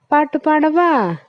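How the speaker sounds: eager little offer to sing; coconut's voice. Acted. Coconut offers to sing for the boy, high quick voice. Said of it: "தேங்காய்".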